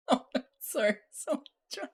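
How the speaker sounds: high, squeaky words broken by laughter; real giggling fit, unable to finish; helpless laughter. Real, not acted. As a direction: try to speak while cracking up. Cracking up while speaking.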